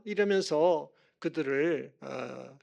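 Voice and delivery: usual speaking voice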